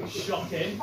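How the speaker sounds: normal talking voice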